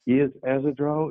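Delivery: usual speaking voice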